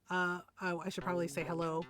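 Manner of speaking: ordinary delivery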